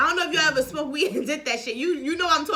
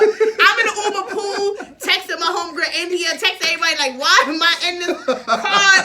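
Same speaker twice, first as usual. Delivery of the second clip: laughing panic; 4 semitones higher, 5 dB louder than usual. Retold or imitated. Texting her homegirl from the Uber pool, laughing panic. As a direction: relive the freak-out, laughing.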